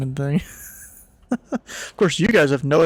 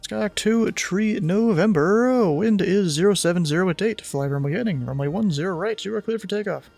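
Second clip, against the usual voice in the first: ATC radio voice; 5 semitones higher than usual. Acted. Takeoff clearance on radio.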